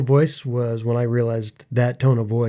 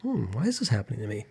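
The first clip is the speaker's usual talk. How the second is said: curious and gentle; 9 dB quieter, much faster than usual. Retold or imitated. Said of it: "Instead of be like".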